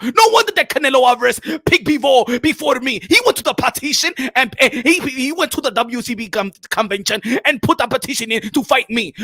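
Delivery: put-on indignant African boxer, heavy accent; ranting boxer demanding his due; worked-up African-accented protest; Makabu.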